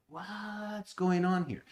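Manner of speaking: high, slow, drawn-out bafflement; puzzled wonder. Retold or imitated. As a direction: ask it as if truly stumped, stretched out high. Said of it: "you encounter something that's like".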